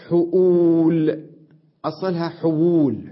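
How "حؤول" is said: حؤول said slowly and loudly, with a clear hamza on the waw; carefully spelled-out word. Shown as a demonstration. Teacher showing students the word حؤول, slow and loud. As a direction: stretch out حؤول so the glottal stop on the waw is clear. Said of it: "وعندي كلمة".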